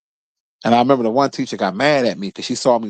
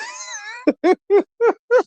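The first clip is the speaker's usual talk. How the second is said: very high squealing laugh; real high laughter. Real, not acted. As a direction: burst out laughing. Laughing at fake boots.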